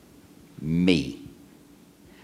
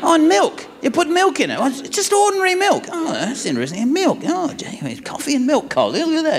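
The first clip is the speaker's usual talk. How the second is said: high, hammed-up amazement; imitated gushing fascination; excited; 11 semitones higher than usual. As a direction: marvel at the most ordinary detail. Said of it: "he's so excited".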